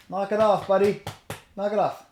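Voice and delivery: high, loud command to a dog; sharp scolding. Real, not acted. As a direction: tell the dog off firmly. Scolding a dog about to bark.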